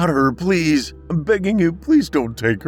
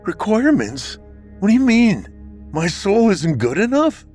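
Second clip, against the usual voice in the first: much higher, louder, indignant questioning; almost defiant; 14 semitones higher, 4 dB louder than usual. Acted. Husband challenging Death's verdict, almost defiant. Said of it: "Jordan asked almost defiantly".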